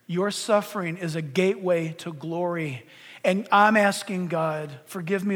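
low, hushed prayer; quiet prayer. Real, not acted. Preacher asking God to forgive his previous prayers, low and prayerful. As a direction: soften and drop low, confessing to God.

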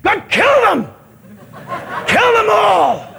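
high, hammed-up outburst; exasperated Moses. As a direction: plead with God at the end of your rope. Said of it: "he goes and he talks to God".

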